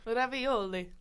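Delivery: put-on a light, playful Italian accent on the dish name; mock Italian accent. Reading menu dishes to his cohost in a mock Italian accent. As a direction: say it like a hammed-up Italian waiter. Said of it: "You can just say anything in an Italian accent".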